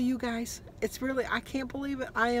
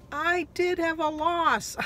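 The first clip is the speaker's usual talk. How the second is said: higher, louder, slow reveal; excited reveal; 8 semitones higher, 4 dB louder, slower than usual. Real, not acted. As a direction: savour the good news slowly. Announcing weigh-in result.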